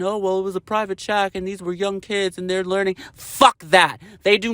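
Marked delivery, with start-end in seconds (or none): 0.0-3.0 soft, sneering imitation of the excuse; a mocking, hushed parody of their defense; mocking quote. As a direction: mimic them dismissively, much softer. Mocking the other side's excuse.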